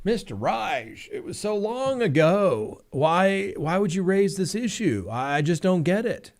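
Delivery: high, slow, condescending judge voice; dismissive judge. Retold or imitated. Lawyer mimicking a judge brushing off his objection, high and slow. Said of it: "the judge poo-pooed it like".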